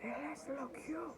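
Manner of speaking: thin, high, slow Spanish line; high character voice. Acted. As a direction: say it softly in a high put-on voice.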